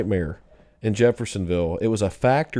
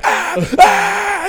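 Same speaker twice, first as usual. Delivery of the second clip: very high, loud frightened yelps; scared scream; 7 dB louder than usual. Retold or imitated. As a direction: scream in a panic. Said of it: "You're just like".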